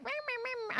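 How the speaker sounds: squeaky mocking imitation; mocking whine. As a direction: mimic his complaint as silly squeaky meows. Mocking his whiny complaint to her.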